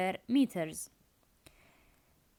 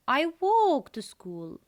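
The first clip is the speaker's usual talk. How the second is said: "walk" punched louder and higher; stress on walk; 5 semitones higher, 5 dB louder than usual. Shown as a demonstration. Teacher showing students where the stress falls, "walk" stressed. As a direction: lean hard on the verb "walk". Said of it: "verb walk is stressed".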